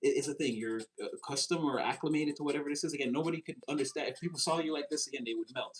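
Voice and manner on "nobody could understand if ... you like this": ordinary delivery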